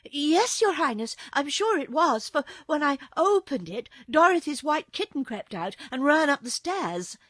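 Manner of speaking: high, loud, eager account; agitated maid. A narrator's reading. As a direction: report urgently as a flustered maid.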